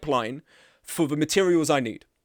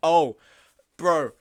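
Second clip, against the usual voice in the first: normal talking voice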